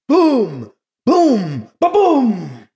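loud, high-starting booms that fall away; hammering voiced explosions; imitated explosion booms. A narrator's reading. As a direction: boom out each blast. Narrator imitates approaching explosions.

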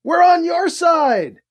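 high, loud, slow yell; shout. A narrator's reading. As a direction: slowly yell it so they hear. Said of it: "he shouted".

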